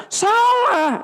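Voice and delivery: high sharp mock "Salah"; curt mock verdict. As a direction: snap out the wrong answer. Wrong answer acted. (ucapan "Salah" tinggi dan ketus)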